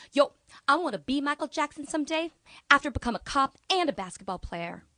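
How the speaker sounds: high, loud boast; eager teen student. Retold or imitated. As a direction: say it like a proud kid. Voicing Lejean's dream.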